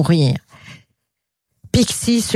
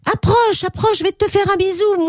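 very high-pitched eager cry; excited little storybook character; Pixie calling out; 13 semitones higher than usual. Acted. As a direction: call eagerly to someone far off, voice pushed right up. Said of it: "Et Pixie criait".

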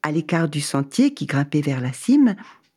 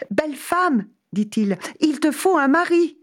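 excited traveller; 11 semitones higher than usual. Acted. Man shouts to woman.

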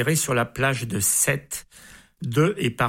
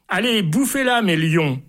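high, loud thundering order; furious emperor ordering the lions to eat her; emperor bellows; 5 semitones higher, 3 dB louder than usual. Acted. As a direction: roar the command at the lions. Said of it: "César gueule, ordonne et tonne".

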